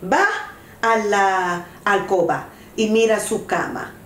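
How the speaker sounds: usual speaking voice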